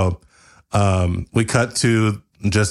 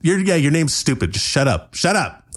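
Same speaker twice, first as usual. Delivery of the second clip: annoyed; 7 semitones higher than usual. Acted. Arguing characters.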